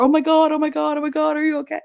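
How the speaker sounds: high-pitched imitation of worried son; alarmed. Son diving to the floor by mother, imitated alarm. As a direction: rush it out high, imitating panic.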